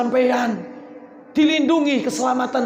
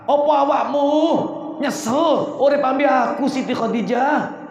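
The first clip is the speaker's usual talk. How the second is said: raised, pleading preacher's voice as the Prophet; shouting as the Prophet; 4 semitones higher than usual. Acted. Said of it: "Teriak saya".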